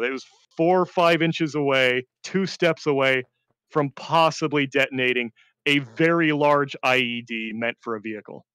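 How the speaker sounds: plain reading voice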